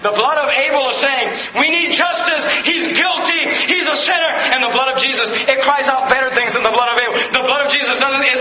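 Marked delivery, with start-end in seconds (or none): 1.6-4.3 accusing cry. Acted. Abel's blood voiced accusing.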